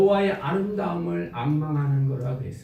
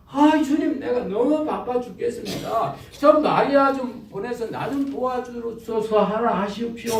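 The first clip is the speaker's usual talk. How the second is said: higher, pleading Martha praying to the Lord; a put-upon woman begging for help, pitched up; overworked Martha whining to Jesus, higher voice; Martha's complaining prayer; 6 semitones higher than usual. Acted.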